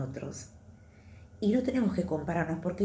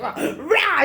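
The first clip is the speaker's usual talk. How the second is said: mock roar; 10 semitones higher, 5 dB louder than usual.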